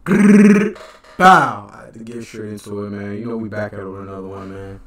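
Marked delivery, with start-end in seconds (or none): 0.0-1.7 growl bark. Acted. Signature intro growl sound.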